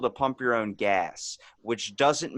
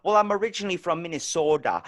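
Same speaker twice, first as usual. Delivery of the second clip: imitated Minnesota accent, pitched higher; 5 semitones higher, 3 dB louder than usual. Woman admitting where she is from, Minnesota accent. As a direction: put on a mock Minnesota accent. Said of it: "I hear the Minnesota in it".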